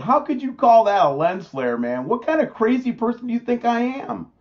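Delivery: high-pitched incredulous protest; indignant disbelief. Real, not acted. Host answering critics who dismiss his footage, indignant disbelief. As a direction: push back in disbelief, pitch climbing.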